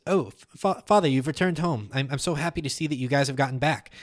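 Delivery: put-on an eager, jittery boy greeting his father; thin creature voice, stumbling over words; nervous young son. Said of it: "you hear a voice come from behind the chair".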